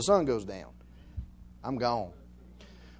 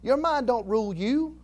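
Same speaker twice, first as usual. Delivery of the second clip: ordinary delivery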